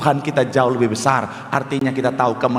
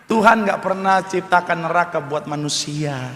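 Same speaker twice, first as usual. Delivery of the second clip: normal talking voice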